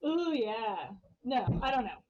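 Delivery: ordinary delivery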